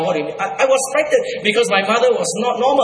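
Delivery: ordinary talk, nothing marked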